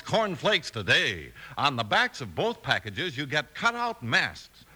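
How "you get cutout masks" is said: ordinary talk, nothing marked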